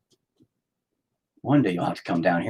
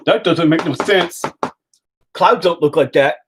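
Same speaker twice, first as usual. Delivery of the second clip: loud, higher, choppy put-on voice; voice of logic; 7 semitones higher, 5 dB louder than usual. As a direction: scold as the rigid logical brain. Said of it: "But my logic goes".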